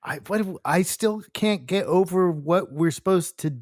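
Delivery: ordinary talk, nothing marked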